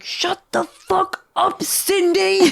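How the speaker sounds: high, slow hammed-up boss outburst; mock-furious high boss voice; imagined exasperated boss. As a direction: play a boss at wit's end, drawing words out. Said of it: "her boss being like".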